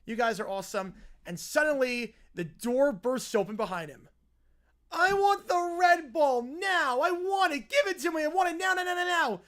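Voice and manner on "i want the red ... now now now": put-on high, loud whiny kid yelling demands; screaming bratty kid. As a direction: throw a tantrum like a spoiled toddler.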